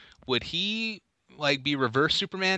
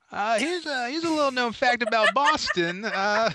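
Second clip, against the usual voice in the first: high-pitched comic character voice; hammed-up know-it-all; 9 semitones higher, 4 dB louder than usual. Bluffing a fact about Boston, hammed-up. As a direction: put on a high, halting trivia buff.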